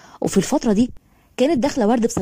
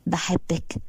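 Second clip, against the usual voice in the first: soft, tender, breathy declaration of love; loving whisper; 4 semitones lower, 7 dB quieter, much slower than usual. A narrator's reading. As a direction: whisper it close to her ear. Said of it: "بنبرة كلها حب وعشق". (همس بحب وعشق)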